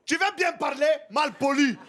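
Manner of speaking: shouted scolding mother; mother shouting at her child in the street, loud scold. Retold or imitated. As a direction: yell it like a strict African mum. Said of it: "il y a la mère qui va passer derrière, qui va dire".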